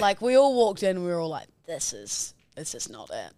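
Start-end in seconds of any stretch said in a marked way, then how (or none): 1.7-3.3 low, hushed, deadpan dismissal; retold unimpressed verdict. Friends walking into the club, quietly unimpressed. Retold group reaction on arriving.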